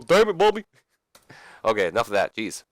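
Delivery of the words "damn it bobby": high, loud Hank Hill yell; exasperated Texan dad yelling at his son. Retold or imitated. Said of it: "did Hank Hill just come in".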